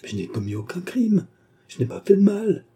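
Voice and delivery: pleading denial, pitch climbing sharply at the end; mildly devastated protest; crushed stammer. A narrator's reading. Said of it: "balbutia-t-elle, anéantie".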